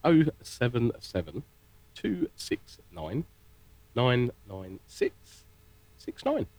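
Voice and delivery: number read out very slowly, digits in spaced groups. Shown as a demonstration. Presenter reading the phone number slowly for listeners, very slow. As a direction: dictate it with long pauses between groups. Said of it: "really slow".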